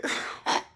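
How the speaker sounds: breathy voiceless giggle; a mock crazed giggle; short unvoiced laugh. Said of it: "I started laughing just like that".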